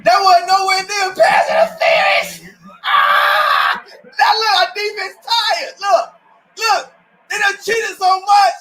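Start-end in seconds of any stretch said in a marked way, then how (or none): 2.8-3.8 very high cry of protest; real frustrated outcry; outraged cry. Real, not acted. Outcry at the call. 3.9-8.5 higher, raised complaining voice; real heated rant. Real, not acted. Ranting at the refs.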